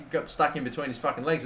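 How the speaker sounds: normal talking voice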